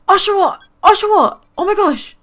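high, loud thrilled shout of the name; excited squeal. Real, not acted. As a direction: burst out with delight at the find. Spots a rare Pokémon.

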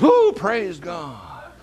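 imitating an excited congregation, loud and drawn-out; high, slow, gushing cheer; mock praise. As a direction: swoon like an over-impressed crowd. Imitates gullible crowd cheering.

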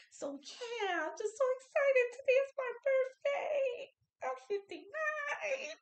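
high, loud, near-crying squeal of joy; overjoyed and welling up with tears; excited tears. Real, not acted. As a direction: voice cracking with happy tears. Birthday joy breaking into tears.

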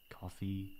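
ordinary delivery

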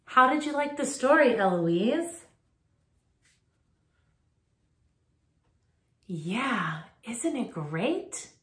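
loud, fast cheerful question; excited. Real, not acted. Addresses child after story.

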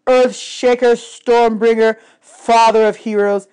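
loud, slow, rising ceremonial hail; grand proclamation. A narrator's reading. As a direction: hail him before the kneeling camp. Chiron proclaims Percy's father.

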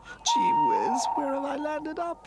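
very high, tearful little voice; acted sob in a high voice; sobbing. A narrator's reading. Lost toy looks around after running away, sobbing. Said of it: "he sobbed".

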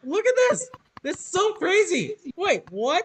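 high excited squeal; thrilled, voice jumping up. Real, not acted. Excited reaction, high pitch.

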